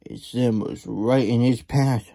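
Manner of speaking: ordinary delivery, nothing marked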